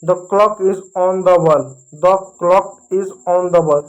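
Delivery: very slow and repeated; slow model sentence. Shown as a demonstration. Teacher drilling the sentence for students, very slow. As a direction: say it twice, slowly and clearly. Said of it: "घड़ी को अंग्रेजी में clock बोलते हैं।".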